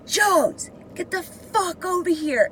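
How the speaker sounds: high, loud angry order; mock yell. Guard orders inmate over, imitated angry yell. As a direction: holler it high as a harsh summons. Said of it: "yelling at me".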